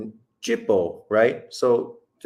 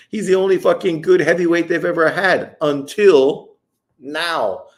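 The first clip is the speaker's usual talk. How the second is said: higher and louder, worked up; heated rant; 6 semitones higher, 6 dB louder than usual. Real, not acted. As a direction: hammer the point home with rising heat. Heated rant about UK fans.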